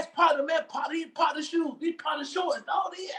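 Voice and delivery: laughing protest. Retold or imitated. Boyfriend retelling his alibi about partner's shorts, high laughing protest. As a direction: plead your case but lose it laughing.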